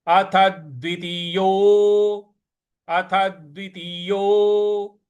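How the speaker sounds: द्वितीयो slow, यो drawn long. Shown as a demonstration. Teacher drills the long यो. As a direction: stretch the यो of द्वितीयो. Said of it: "यो को दीर्घ खींचना है".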